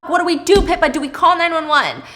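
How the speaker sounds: frantic husband asking what to do; panicked. Retold or imitated. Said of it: "Thomas is like".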